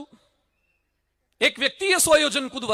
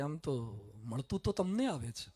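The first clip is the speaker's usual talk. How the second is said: small, much softer and lower voice; meek lamb; 9 semitones lower, 13 dB quieter than usual. Acted. The lamb meekly admits to the lion, soft and low. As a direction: shrink your voice to a timid murmur.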